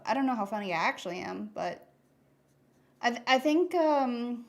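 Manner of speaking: usual speaking voice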